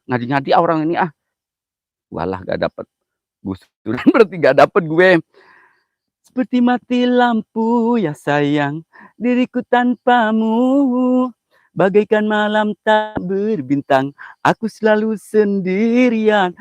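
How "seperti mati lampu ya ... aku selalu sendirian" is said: slow, high, crooning lovesick verse; mock romantic recitation. Streamer recites a corny poem to his followers, slow and crooning. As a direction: croon it slowly like a sappy poet.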